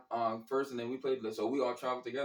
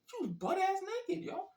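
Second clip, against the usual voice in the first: incredulous squeal. Real, not acted. Emphatic punchline, voice jumps.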